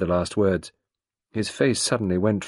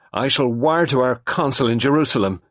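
louder, angry retort; 5 semitones higher, 5 dB louder than usual. A narrator's reading. Lennox answering Poirot's hint, an angry louder retort. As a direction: threaten him with the consul, heated. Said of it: "Lennox Boynton said angrily".

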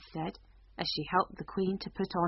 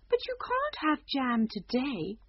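slow, higher queen explaining; 7 semitones higher than usual. Acted. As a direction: spell out the rule slowly. Queen explains jam rule.

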